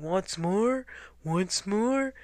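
muffled call with rising ends. A narrator's reading. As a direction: call out as if through a sleeping bag. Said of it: "his muffled voice calling".

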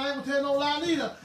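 ordinary talk

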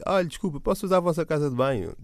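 voz mais aguda de miúda aflita; rapariga aflita aflita. Retold or imitated. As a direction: imita levemente a rapariga apertada. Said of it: "meio aflita para mijar". (miúda aflita pede a casa de banho, voz aguda)

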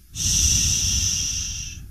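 soft unvoiced shush; shushing whisper. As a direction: hush them gently. Hushing before a meditation bit.

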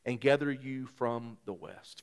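soft, measured reading of scripture. Acted. Pastor reads scripture softly to the congregation. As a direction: read the verses gently to the church.

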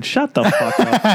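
slow, laughing shut the fuck up; mock scolding through a laugh; laughing at a friend's joke; laughing tease. Real, not acted.

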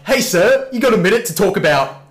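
high, loud salesman call; sales pitch. Retold or imitated. Sales rep stopping the man outside, mock sales pitch. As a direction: hail a passer-by brightly and loudly, as a pitch.

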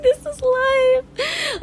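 slow, high, wailing mock-wise tree. Vlogger voicing trees accepting pain, slow high mock-tragic wail. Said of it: "they're like".

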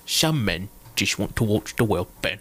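put-on low, slow Cockney accent; a slow, low Cockney growl of Michael Caine; Michael Caine impression. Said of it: "Can you do Michael Caine?".

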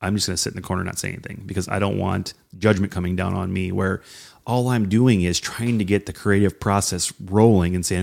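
ordinary talk

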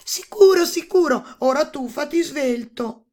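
high, loud, impatient agreement; impatient princess. A narrator's reading. Princess hurrying the toad to fetch her ball, impatient high voice. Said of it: "esclamò impaziente la principessa".

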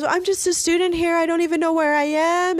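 high-pitched imitated tearful pleading; mock crying. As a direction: mimic a passenger on the verge of tears, voice way up. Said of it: "she starts crying and shit".

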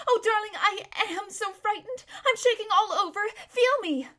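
high, loud fretful whimper; a panicky damsel pleading for comfort; whimpering, frightened. A narrator's reading. Frightened girl telling her friend she is shaking, high whimpering. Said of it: "whimpered Lazareline".

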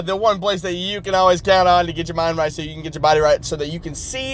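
ordinary talk, nothing marked

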